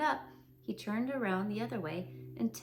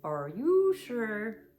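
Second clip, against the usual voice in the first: doubtful Eeyore; 7 dB louder, much slower than usual. Acted.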